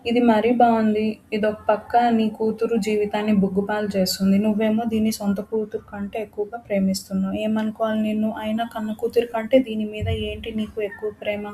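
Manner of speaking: ordinary delivery, nothing marked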